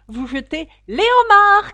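loud, high-pitched cry; excited shout. A narrator's reading. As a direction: shout it at full force. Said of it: "cria le griffon". (crier très fort, avec élan)

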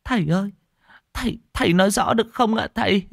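very high, fast and tearful pleading; near tears. Acted. Wife begging the monk to explain, close to tears. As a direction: whimper as if about to cry. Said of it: "nói mếu máo như muốn khóc".

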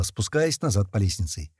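ordinary delivery, nothing marked

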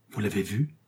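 ordinary delivery

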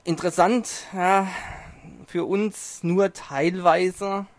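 usual speaking voice

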